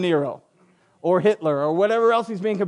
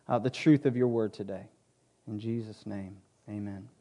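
low, hushed prayer voice; opening prayer; 5 semitones lower than usual. Real, not acted.

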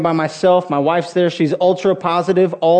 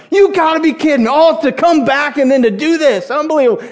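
much higher, exasperated cry; stunned frustration; 8 semitones higher than usual. Retold or imitated. Fan groaning at the TV after Hamilton's homer, high exasperated cry. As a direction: wail it like you can't believe your luck. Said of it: "and I'm like".

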